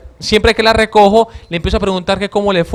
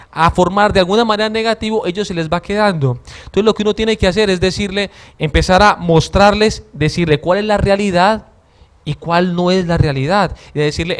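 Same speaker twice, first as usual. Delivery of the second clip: normal talking voice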